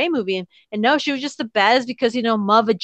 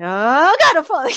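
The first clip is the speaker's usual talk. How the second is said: high wail swooping up, hammed-up panic; mock falling cry; 5 semitones higher, 4 dB louder than usual. Fan imagining heavy gun pulling Judith over, mock panicked cry. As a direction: cry out as if toppling over, played for laughs.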